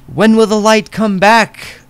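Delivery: high, loud, slow lament; plaintive prophet. Acted. The prophet cries out for the light's return, plaintive. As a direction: ask it longingly of the heavens.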